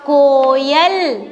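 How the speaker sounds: कोयल drawn out very slowly as one word; very slow blend. Shown as a demonstration. Teacher models reading कोयल for pupils, very slowly. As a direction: stretch कोयल smoothly. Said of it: "इसको हम पढ़ेंगे".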